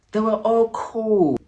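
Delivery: high, slow mocking lilt; sarcastic sing-song. Real, not acted. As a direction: drawl it sarcastically.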